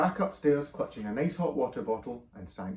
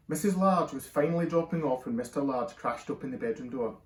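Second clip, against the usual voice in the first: ordinary delivery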